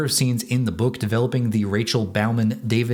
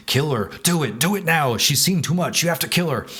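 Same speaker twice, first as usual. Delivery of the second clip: a sinister voice in his head urging murder, higher and more insistent; evil inner voice; 4 semitones higher than usual. Retold or imitated. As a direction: hiss it like a voice goading him to kill. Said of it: "battling with an evil voice in his head".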